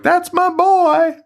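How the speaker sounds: put-on girl cheering her hero, high and slow; proud girl. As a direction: coo it up high like a doting fan.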